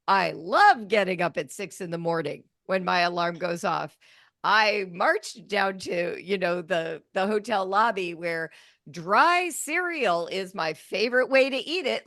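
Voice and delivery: mock enthusiasm, laying it on thick; sarcastic. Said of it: "totally sarcastic direction".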